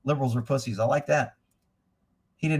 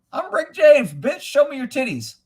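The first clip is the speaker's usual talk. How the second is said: high, loud mock [name]; [name] impression; 10 semitones higher, 5 dB louder than usual. Streamer quoting the comedy sketch in a loud, high voice. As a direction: shout it like the famous sketch. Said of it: "Eddie Murphy did that shit".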